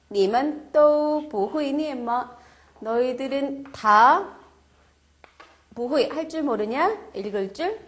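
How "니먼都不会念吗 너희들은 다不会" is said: loud and very slow, syllable by syllable; slow model reading. Shown as a demonstration. Teacher modelling the question loudly and slowly. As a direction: project each tone slowly. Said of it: "model sentence read out".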